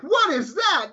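high-pitched retold astonishment; lightly hammed-up disbelief; mock bafflement. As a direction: act out being puzzled by an odd accent. Retells own baffled reaction.

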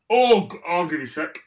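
ordinary talk

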